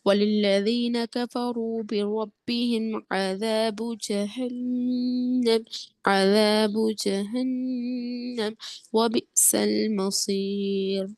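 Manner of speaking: slow, drawn-out tajweed chant; Quran recitation. Shown as a demonstration. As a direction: recite slowly, stretching the long vowels. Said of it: "قواعد کا خیال رکھ کے پڑھیے".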